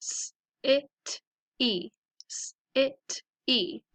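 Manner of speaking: "city" sound by sound, s, i, t, ee; segmented phonics "city". Shown as a demonstration. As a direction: sound out "city" twice with gaps. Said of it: "So let's practice them".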